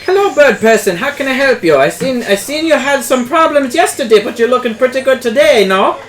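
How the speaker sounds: shopkeeper NPC. Acted. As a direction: greet the customer as a chatty shopkeeper. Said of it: "my favorite N P C".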